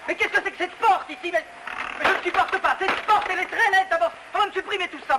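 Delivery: high-pitched fussy tirade; exasperated. Acted. Director demanding an ugly door be removed, exasperated.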